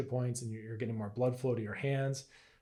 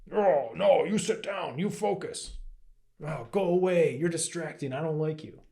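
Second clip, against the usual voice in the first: louder, stern telling-off, higher pitched; harsh scolding; 4 semitones higher, 5 dB louder than usual. Retold or imitated. Teacher voicing harsh self-talk at the mind, loud scolding. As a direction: snap at it like an impatient parent shooing a pest.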